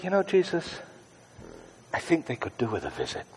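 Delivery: hushed, gentle prayer; soft prayer. Shown as a demonstration. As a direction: say it softly as a prayer. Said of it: "And just say".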